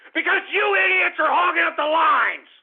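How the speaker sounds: loud, high angry yell; angry outburst. Real, not acted. Host yells at callers hogging lines, angry. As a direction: blast the callers.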